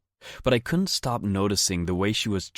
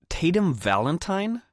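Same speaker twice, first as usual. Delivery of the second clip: much higher, incredulous sneer; disdainful tone; 11 semitones higher than usual. A narrator's reading. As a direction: sneer out the name in disbelief. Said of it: "in a tone that I didn't like at all".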